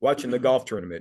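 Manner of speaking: ordinary talk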